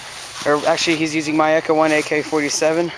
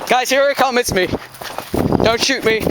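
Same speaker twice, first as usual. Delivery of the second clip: calling out; 7 semitones higher than usual. Real, not acted. Calling out to teammates.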